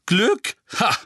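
high, loud echo, sharp "Ha!"; scoffing disbelief. A narrator's reading. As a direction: throw the word back with a scoff. Witch scoffs at Harry's word. (spöttisch, hoch und laut)

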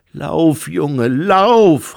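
loud, high urgent shout. A narrator's reading. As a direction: yell at the boy to run. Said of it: "rief Lucé". (Lucé ruft laut und drängend)

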